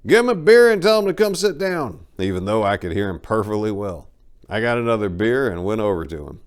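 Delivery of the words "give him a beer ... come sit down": high, loud shout across the tavern; owner yelling back. Retold or imitated. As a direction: bellow the order to the barman. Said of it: "The owner yelled back".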